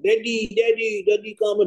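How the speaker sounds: put-on high, eager child calling Daddy; excited child. Child greeting daddy home from work, high excited calls. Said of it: "Anaknya bilang".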